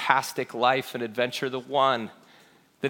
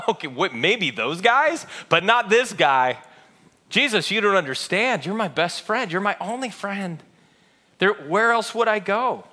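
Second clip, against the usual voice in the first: cocky Peter; 6 semitones higher than usual. Retold or imitated. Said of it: "And Peter, of course, is the one who goes".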